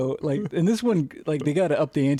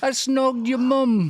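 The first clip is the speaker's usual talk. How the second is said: high, slow hammed-up British accent; British accent parody, pitched up and slow; mock British accent; 10 semitones higher, much slower than usual. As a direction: drawl it out as a cheeky Brit. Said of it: "work the word snog".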